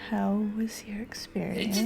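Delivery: slow breathy whispered radio host; late night radio dj. Acted. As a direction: purr it like a midnight radio host. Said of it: "I'm doing my late night D J voice".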